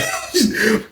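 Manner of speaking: short laugh as they joke; real chuckle. Real, not acted. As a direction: laugh it off together. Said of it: "we laughed about that shit".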